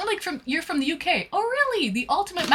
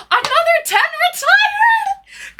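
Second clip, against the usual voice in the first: high, slow, cracking cry of disbelief; stunned by another gift; overwhelmed disbelief; 8 dB louder, slower than usual. Real, not acted. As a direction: let the voice break in amazement.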